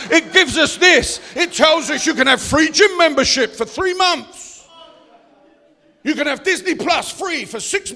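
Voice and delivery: loud, pounding sermon lines; preaching. Acted. Pastor warning the church about the world's little treats, loud preaching. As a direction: sell the world's treats with mock force.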